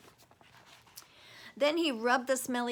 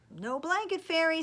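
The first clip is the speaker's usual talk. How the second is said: put-on high, loud triumphant child; 8 semitones higher, 9 dB louder than usual. Owen telling his parents no fairy came, triumphant. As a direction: crow it proudly.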